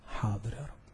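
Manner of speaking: low, very soft reply with a lift; hushed, humble assent; meek obedience. Acted. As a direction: answer submissively, under your voice. Acted meek reply to God.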